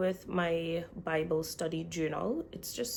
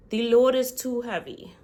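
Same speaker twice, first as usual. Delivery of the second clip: loud, higher retold cry; Moses crying out; 5 semitones higher, 11 dB louder than usual. Retelling how Moses cried out to God, loud cry. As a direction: call out the burden loudly. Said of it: "Moses cried out".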